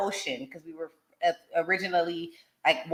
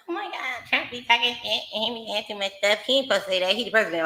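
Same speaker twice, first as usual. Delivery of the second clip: mocking complainer; 4 semitones higher than usual. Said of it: "when people was like".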